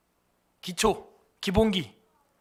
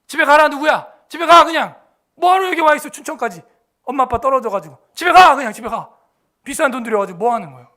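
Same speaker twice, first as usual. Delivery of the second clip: higher, much louder scolding; imitating coach telling kids off; 4 semitones higher, 8 dB louder than usual. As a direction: send them home in disgust. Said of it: "그 감독이 하는 얘기".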